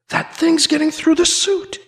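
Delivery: high-pitched, acted alarm; alarmed realisation. A narrator's reading. Hero alone in the airlock, acted alarm. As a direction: realise the danger with rising pitch.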